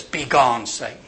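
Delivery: low, gentle dismissal; loving rebuke. Acted. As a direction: say it tenderly, dropping away at the end.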